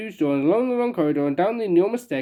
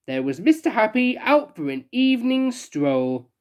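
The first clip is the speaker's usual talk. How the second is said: ordinary delivery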